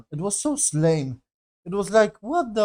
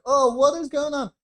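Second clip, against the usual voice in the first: mock panicked villagers; 9 semitones higher than usual. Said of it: "So they were like".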